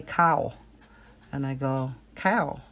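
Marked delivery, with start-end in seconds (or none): 2.1-2.6 "cow" with a southern twang. Retold or imitated. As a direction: twang "cow" toward "kay-ow". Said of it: "It's not cow".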